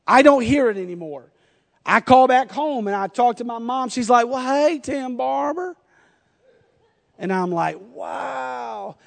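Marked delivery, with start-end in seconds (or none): 4.3-5.8 mom's Southern hello, higher and softer, rising at the end; lightly imitated Southern drawl from mom. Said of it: "lost my Southern accent". 7.9-9.0 drawn-out, hushed wow, higher and much softer; soft mock amazement; amazed wow. Amazed at mom's accent.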